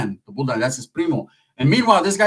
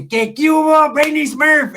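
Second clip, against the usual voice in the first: high, louder teasing impression; Pepe; 12 semitones higher, 4 dB louder, slower than usual. Cousin teasing another in Smurfland, high put-on voice. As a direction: pitch it way up, mocking the cousin. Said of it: "Pepe's like".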